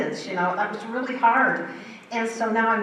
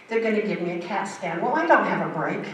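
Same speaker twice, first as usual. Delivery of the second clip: ordinary talk, nothing marked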